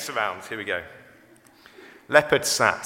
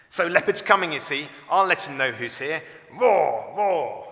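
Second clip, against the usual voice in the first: cocky challenge; 5 semitones higher, 6 dB louder than usual. A narrator's reading. Toto challenges the coming leopard.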